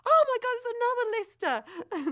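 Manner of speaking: loud, high, incredulous squeal of delight; excited retold policeman. Policeman looking into the back of the car, excited. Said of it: "he's so excited".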